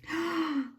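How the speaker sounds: high, quick gasp of discovery; excited gasp. Real, not acted. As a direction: suck in breath, thrilled. Discovers napkin in gift.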